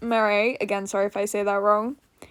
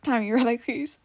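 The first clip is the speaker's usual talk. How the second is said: plain reading voice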